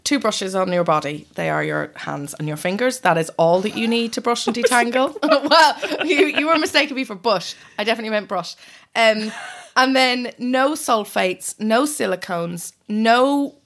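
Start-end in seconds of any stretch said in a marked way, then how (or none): 5.2-7.5 laughing high and fast. Real, not acted. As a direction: giggle through the words. Laughs at mishearing.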